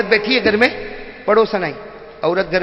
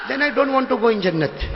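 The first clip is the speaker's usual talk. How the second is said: put-on slow, softer British-tinged young man; young English-speaking man; 2 semitones higher, 8 dB quieter, much slower than usual. Young man asking the maulana, slow and soft. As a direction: drawl it like a Londoner.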